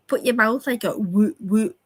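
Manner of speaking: ordinary talk, nothing marked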